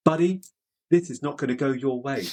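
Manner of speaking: high mock English accent, playful; imitating an English accent. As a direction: do a jokey English accent for the mate. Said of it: "in his best English accent".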